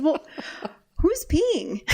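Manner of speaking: slow, laughing high question; laughing disbelief. Retold or imitated. Mother asks son who is peeing, slow and laughing. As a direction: drag it out through a laugh. Realizing someone else is peeing.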